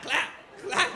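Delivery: high, loud clap imitation; mock clap sound. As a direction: smack the word like a slap. Imitating slapping heels.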